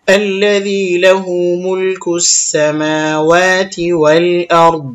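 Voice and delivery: very slow, elongated tajweed chant; Quran recitation. Shown as a demonstration. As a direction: recite with long vowels to the stop. Recites the verse up to the stop.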